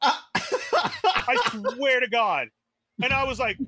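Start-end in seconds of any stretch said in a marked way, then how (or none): 0.0-2.5 laughing through the words; can't stop laughing as you swear it. Real, not acted. Telling friends the kid story, laughing.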